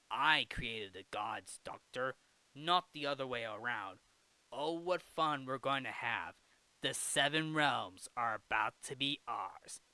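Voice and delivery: low, gloating supervillain voice; evil caterpillar. Acted. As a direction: relish each word like a scheming mastermind. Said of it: "who's basically this evil caterpillar".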